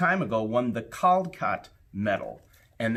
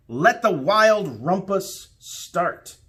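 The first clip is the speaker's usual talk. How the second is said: slow, loud, higher proclamation; cried out; 7 semitones higher, 6 dB louder, much slower than usual. Acted. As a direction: announce the party like a little king. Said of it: "cried Max".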